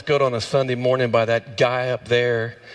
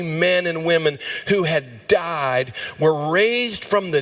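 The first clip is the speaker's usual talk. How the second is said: raised, high preaching voice; fervent preaching; 7 semitones higher than usual. Real, not acted. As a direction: proclaim the scripture with rising fervour. Preaches the resurrection account with fervour.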